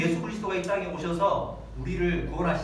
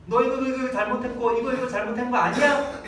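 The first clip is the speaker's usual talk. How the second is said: louder, higher scolding; scolding mother; 5 semitones higher, 4 dB louder than usual. Retold or imitated. Mother scolding her crying child, louder and higher. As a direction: press the child about what it did.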